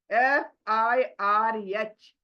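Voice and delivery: normal talking voice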